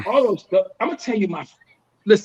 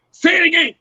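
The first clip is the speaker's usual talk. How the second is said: mock fan call-out, higher; imitated fans; 6 semitones higher than usual. Fans spot him at airport, high mock call-out. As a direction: holler at a celebrity in passing.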